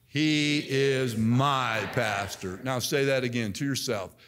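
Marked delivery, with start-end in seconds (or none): 0.1-2.6 "He is my pastor" slow, with "my" given weight; slow stress on my. Shown as a demonstration. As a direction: draw it out and lean on "my". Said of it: "Number three, "my".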